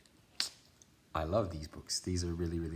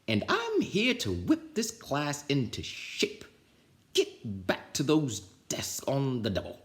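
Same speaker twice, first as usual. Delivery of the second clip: slow, scratchy commanding voice; stern witch teacher; 6 semitones higher than usual. Acted. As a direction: bark orders at the class. Swamp bossing class.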